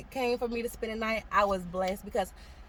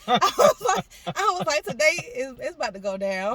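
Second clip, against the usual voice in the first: loud panic; 6 semitones higher, 7 dB louder than usual. Real, not acted. Panic as things escalate.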